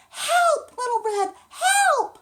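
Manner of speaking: very high, loud cry for help; calling for help. Acted. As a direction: shout from inside the closet. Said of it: "she heard her grandmother's voice".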